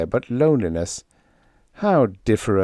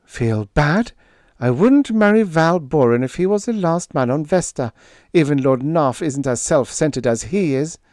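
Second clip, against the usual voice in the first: put-on higher, scornful young woman; indignant Lila; 6 semitones higher, 3 dB louder than usual. A narrator's reading. Lila scornfully rejects marrying Val. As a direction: scoff at the very idea.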